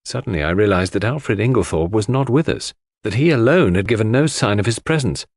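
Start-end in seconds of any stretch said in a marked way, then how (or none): none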